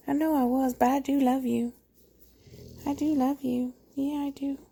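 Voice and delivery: high, soft voice for a pet; apologetic cat voice. Retold or imitated. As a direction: speak as the cat making up with mama.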